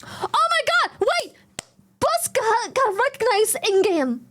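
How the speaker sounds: high gasping excitement; shocked, squealing excitement; surprised gasp. Real, not acted. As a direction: gasp then blurt the news.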